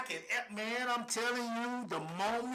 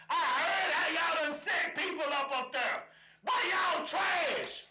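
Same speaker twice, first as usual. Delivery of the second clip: high, shouted indignation; real outrage at full voice; furious accusation; 5 semitones higher than usual. Real, not acted. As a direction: build to a slow, drawn-out holler. Climax of angry accusation.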